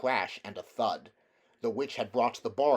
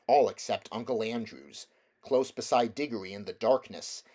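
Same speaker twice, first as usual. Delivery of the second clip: plain reading voice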